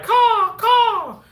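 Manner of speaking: high, loud bird-like caw; silly cawing. Acted. Silly worker finishing up a case, high cawing. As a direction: squawk out two silly caws. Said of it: "I'm a little silly".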